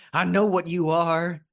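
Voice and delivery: high, scared accusation; frightened accusation. Acted. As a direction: spit it out in fear, voice up high. Panicked gunman accuses.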